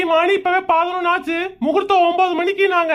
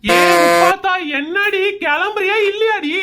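impatient calling out. Acted. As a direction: holler for them to get moving. Husband calls wife to hurry up.

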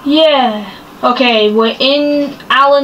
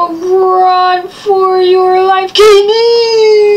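high, drawn-out yell; 6 semitones higher, 3 dB louder, much slower than usual. Real, not acted. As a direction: shout the warning. Fleeing danger, yelling to friend.

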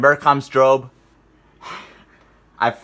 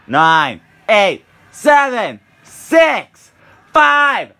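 shouted countdown, building higher; new year countdown crowd; 9 semitones higher, 6 dB louder, much slower than usual. Real, not acted. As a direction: yell the last seconds to midnight.

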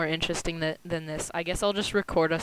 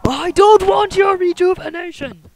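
squeaky high voice; 13 semitones higher, 9 dB louder than usual. Acted. Refusing a heal in-game.

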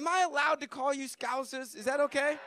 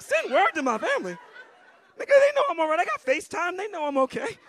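incredulous retort; 6 semitones higher, 5 dB louder than usual. Retold or imitated. His retort, high and loud.

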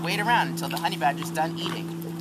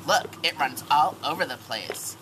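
normal talking voice

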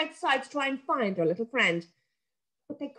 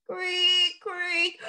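creaking rope; 8 semitones higher than usual. A narrator's reading. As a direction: mimic an old rope creaking. Rope noise imitated.

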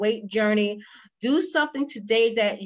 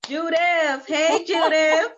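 calling out; 4 semitones higher, 3 dB louder, slower than usual. Real, not acted. Calling to a guest on the call.

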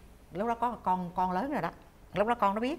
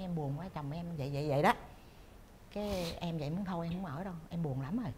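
low, soft and downcast; retold sadness; 3 semitones lower, 7 dB quieter than usual. Wife confiding in an old friend about her husband, subdued. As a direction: quietly confide a past heartbreak, understated. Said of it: "Thì cô mới buồn quá".

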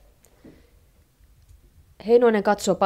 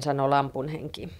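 ordinary delivery, nothing marked

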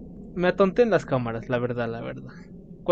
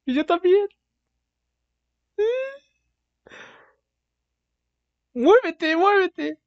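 falsetto voice; slower than usual. Acted. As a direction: squeal it in falsetto. Squeaky falsetto bit.

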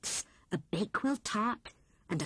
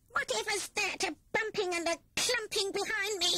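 rat's voice pitched much higher, a bit alarmed; startled rat; 11 semitones higher than usual. A narrator's reading. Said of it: "said he to himself".

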